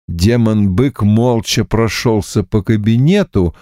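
ordinary delivery

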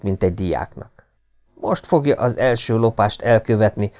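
plain reading voice

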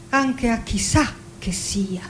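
normal talking voice

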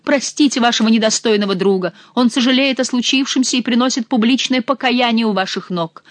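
ordinary delivery, nothing marked

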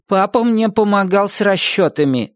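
put-on high, mild little-boy modesty; modest. A narrator's reading. Narrator quoting Tom Swift crediting his dad, modest. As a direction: answer lightly and high, playing humble. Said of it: "скромно ответил Том".

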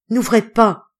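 grave warning. A narrator's reading. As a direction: forbid it gravely, with force. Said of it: "madame Roubion dit gravement".

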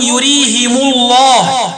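"يريهم الله" as yurihimu-llah; slow link, i after h and u after m in "يريهم"; kasr ha, damm mim. Shown as a demonstration. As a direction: recite "يريهم الله" with -himu. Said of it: "بكسر الهاء وضم الميم وصلا".

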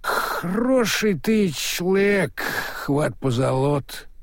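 put-on slow drunken hiccuping praise; drunk, hiccup through it. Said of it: "проикал Слыпень".

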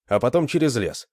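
plain reading voice

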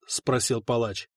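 ordinary talk, nothing marked

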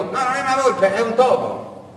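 high, loud correcting husband; the husband correcting his wife about the fox; patient husband. Acted. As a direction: set her straight with a sigh. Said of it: "E il marito".

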